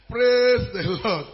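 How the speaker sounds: ordinary talk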